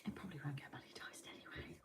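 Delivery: hushed, fast aside under the breath; a quick conspiratorial whisper. Real, not acted. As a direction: slip it in as a secret. Aside under breath about demonetizing.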